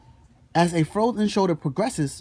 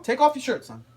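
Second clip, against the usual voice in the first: high-pitched, firm command; insistent order; 7 semitones higher than usual. Acted. Father answering his son's doubtful question, insistent order. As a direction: repeat the order, allowing no argument.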